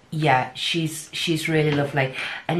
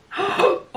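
sharp high gasp; 5 dB louder than usual. Real, not acted. Seeing the swatch appear.

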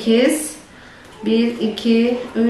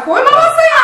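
sharp call; 13 semitones higher, 4 dB louder than usual. Real, not acted. Mother tells child off.

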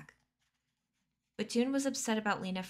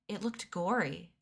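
ordinary delivery

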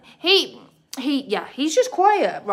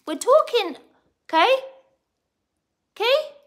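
very high, raised voice; scolding pet talk; 14 semitones higher, 5 dB louder than usual. Real, not acted. Owner tells interrupting bird off, high-pitched scolding.

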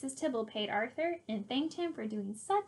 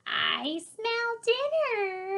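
high, very slow, drawn-out creature voice; monster voice; 7 semitones higher, much slower than usual. A narrator's reading. Swamp thing at the front door, creepy drawn-out voice. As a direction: stretch every word out like a creeping monster.

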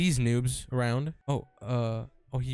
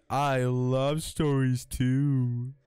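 slow mock drawl; 3 semitones lower, 3 dB louder, much slower than usual. Echoing the host's line.

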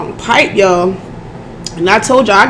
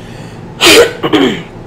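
throat clearing. Real, not acted. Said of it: "I'm sorry I keep doing that".

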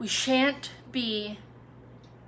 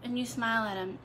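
ordinary talk

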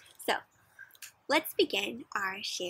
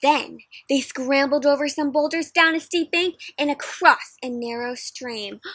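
usual speaking voice

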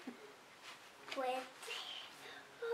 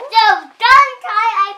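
excited shout; 5 semitones higher, 16 dB louder than usual. Real, not acted. Child shouting to mom about the toy plane, loud and eager. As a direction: blurt it out full of excitement.

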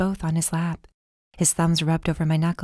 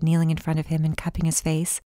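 ordinary delivery, nothing marked

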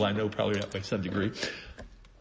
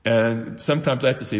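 ordinary talk, nothing marked

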